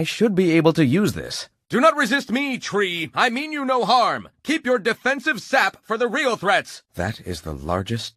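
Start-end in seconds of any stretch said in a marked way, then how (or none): 1.7-6.8 much higher, coaxing voice; pleading with tree. Acted. Hero talks the tree into cooperating, high and coaxing. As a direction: soothe the tree into giving way.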